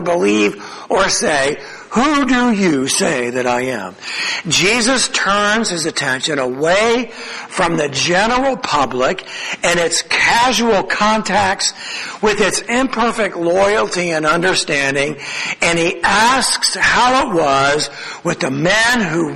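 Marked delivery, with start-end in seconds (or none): none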